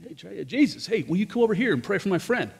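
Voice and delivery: high, eager call across a crowd. Acted. Man calls Jesus to heal his friend, eager high call. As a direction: hail him from a distance. Calling Jesus again, pleading.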